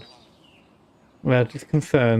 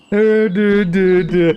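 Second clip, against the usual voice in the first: teasing mock voice; 3 semitones higher, 4 dB louder, much slower than usual. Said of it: "I'll make her sound like you".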